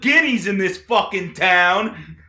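mock rant. Co-host airs his weekly gripe, building mock rant. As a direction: rant it up like a wound-up barstool gripe.

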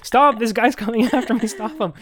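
hammed-up cry for help; laughing mock panic. Susan fleeing Elvis down the hallway, high, laughing mock panic. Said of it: "and she's like".

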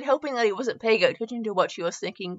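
ordinary delivery

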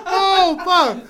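high, loud laughing words; cracking up mid-sentence. Real, not acted. Cracking up at Mister Peanut.

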